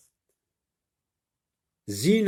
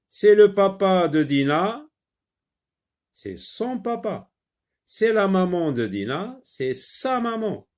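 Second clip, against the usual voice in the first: high, bright, bouncing up on son papa and sa maman; sing-song it up high to catch the child's ear; lively teacher voice; 7 semitones higher, 4 dB louder than usual. Shown as a demonstration. Teacher stressing son and sa for the pupil. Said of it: "Quand est-ce qu'on dit ça".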